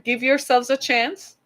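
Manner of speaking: ordinary delivery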